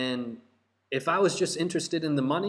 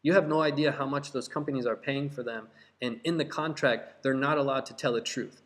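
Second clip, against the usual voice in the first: ordinary delivery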